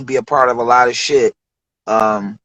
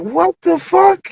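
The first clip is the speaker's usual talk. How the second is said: slow, much higher stunned question; amazed; 13 semitones higher, slower than usual. Retold or imitated. Producer handed a big bag of weed, slow and high. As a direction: drag it out in disbelief.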